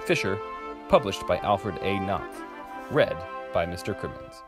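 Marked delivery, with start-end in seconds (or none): none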